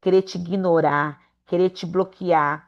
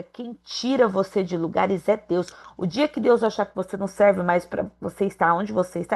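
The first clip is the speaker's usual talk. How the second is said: ordinary talk, nothing marked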